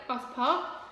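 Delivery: ordinary delivery, nothing marked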